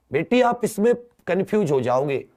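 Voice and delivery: usual speaking voice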